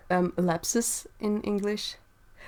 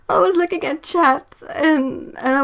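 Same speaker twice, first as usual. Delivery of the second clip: explaining through laughter; 5 semitones higher, 5 dB louder than usual. Real, not acted. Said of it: "I broke myself".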